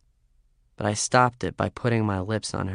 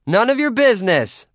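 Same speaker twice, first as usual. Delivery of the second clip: shouted reply; 12 semitones higher, 7 dB louder than usual. Acted. Shouting back down.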